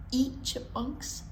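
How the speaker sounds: ordinary delivery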